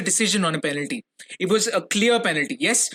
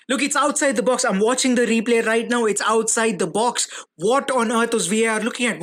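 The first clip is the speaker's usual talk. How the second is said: higher, indignant rant at the replay; real disbelief, pitched up at the referees; exasperated outburst; 5 semitones higher than usual. Real, not acted. Rant about penalty on replay.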